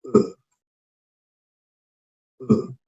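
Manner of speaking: a soft breathy "u", between u and o; quiet demo of the vowel between u and o; rounded vowel sound. Shown as a demonstration. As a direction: whisper the "u" with rounded lips. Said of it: "Este sonido es u".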